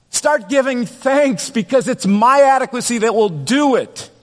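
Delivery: preaching at full force. Acted. Pastor voicing Moses and God to his congregation, preaching hard. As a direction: lean into it like a preacher at the pulpit.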